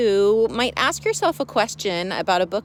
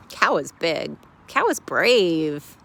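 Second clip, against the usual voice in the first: normal talking voice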